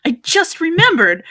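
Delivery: high-pitched excited realization; acted excitement, pitched well up; really excited. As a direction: blurt it out as a sudden happy recollection. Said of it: "I get really excited and go".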